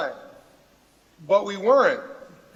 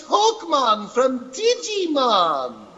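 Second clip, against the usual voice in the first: high, slow cartoon character voice; pitched-up animated character introducing himself; Hawkmon; 4 semitones higher, much slower than usual. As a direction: put on a drawn-out cartoon voice. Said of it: "you guys might know me as".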